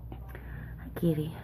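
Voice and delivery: soft whispered greeting; whisper to cat. Real, not acted. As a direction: whisper hello to the cat beside her. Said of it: "The cat's right here next to me".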